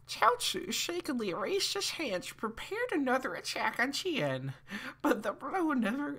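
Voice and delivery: high, hammed-up old man. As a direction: read it as a squeaky old man. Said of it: "I'll do the old man voice".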